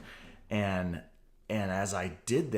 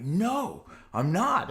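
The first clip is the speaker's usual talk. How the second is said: higher and louder, refusing to quit; defiant shout; 8 semitones higher, 3 dB louder, slower than usual. Retold or imitated. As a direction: fire back a stubborn no. Defiant refusal while being pinned.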